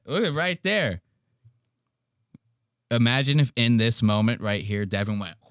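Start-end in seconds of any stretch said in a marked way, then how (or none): none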